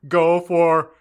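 loud, high, hammed-up yell; shouted author's note. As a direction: belt out a ranting note.

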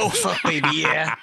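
mock American bedroom talk; imitated American porn-talk; American accent. Shown as a demonstration. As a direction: reprise the line in a put-on American accent. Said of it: "you're gonna say, what was it again".

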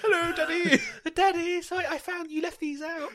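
very high, squeaky kiddie voice; hammed-up childish falsetto; mock little child. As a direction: play the innocent six-year-old caught out. Acting six-year-old caught by dad.

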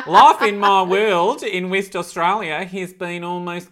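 put-on jokey Australian accent while reading a letter; mock Australian accent. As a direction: put on a playful West Australian twang. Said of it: "doing it in this accent".

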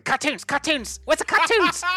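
put-on high shrill child's whine; whiny boy. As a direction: whine like a bratty kid. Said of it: "and he's just going".

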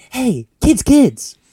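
put-on high, loud call to the kids. Host calling out to imaginary kids, high and loud. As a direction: hail the kids like a cheery presenter.

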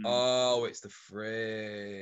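low, soft, very drawn out; deflated, dragged-out mock realisation; mock anticlimax. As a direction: let it sink away slowly and quietly. Acts out relieved, dismissive reaction.

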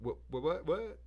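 mock shock. Kid hearing his PS1 is worth little, stammering mock disbelief. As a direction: sputter in disbelief, climbing up. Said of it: "And I was like".

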